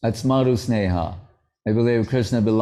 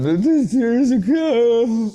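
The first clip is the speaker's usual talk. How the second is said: high, drawn-out wail; mock crying; 12 semitones higher, slower than usual. Mimicking devotees crying one minute, hammed-up tearful wail. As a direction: blubber it out in exaggerated fake tears. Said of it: "and they're crying".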